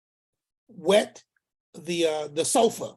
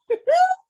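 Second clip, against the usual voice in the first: a sudden high, loud laugh; high burst of laughter; 7 dB louder than usual. Real, not acted. Uncle laughing at his own story, a high loud burst. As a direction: crack up at your own story.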